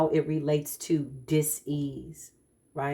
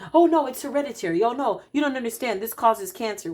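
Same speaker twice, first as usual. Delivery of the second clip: high, fast, fussy mock protest; mocking whiny quote; 6 semitones higher, 3 dB louder, faster than usual. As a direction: mimic the naysayers, higher and quicker. Mocking people who blame heredity.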